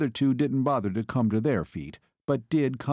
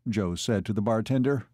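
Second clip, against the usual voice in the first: plain reading voice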